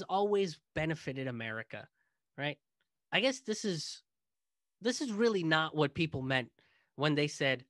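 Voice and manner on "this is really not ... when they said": ordinary delivery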